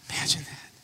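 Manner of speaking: awed whisper; hushed, amazed whisper. Real, not acted. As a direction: breathe it in wonder. Awe at the risen Lord.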